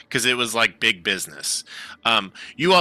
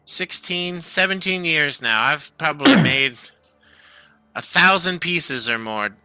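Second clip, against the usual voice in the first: lightly raised halfling voice, chatty; proud halfling craftsman; 6 semitones higher than usual. Acted. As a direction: talk shop with a fan. Keegan talks about his craft.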